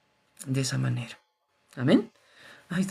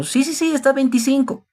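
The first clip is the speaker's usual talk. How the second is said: much higher, bright mock vendor agreeing fast; sly seller; 12 semitones higher, 4 dB louder than usual. Preacher acting a food seller overcharging a foreigner, sly high voice. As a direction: play the cheeky seller padding the price.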